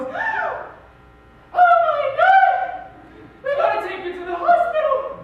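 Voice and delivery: mother screaming in high, loud panic; panicked scream. Retold or imitated. Mother sees dad's severed thumb, screams in panic. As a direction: shriek it out, high and loud, terrified. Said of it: "my mother goes".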